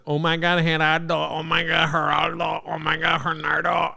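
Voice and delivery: lightly mocking singsong; mocking the song. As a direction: parody the rapper's chant, winding down. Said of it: "never not once have I said".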